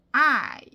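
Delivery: "I" loud and drawn out; full, heavy "I" with wrong emphasis; stressed I. Shown as a demonstration. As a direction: hit "I" hard and stretch it. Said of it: "I에서 강세가 있는 거잖아요".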